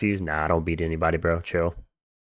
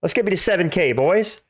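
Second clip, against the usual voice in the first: frat bro; 11 semitones higher than usual. Real, not acted. Streamer hyping subscriber goal.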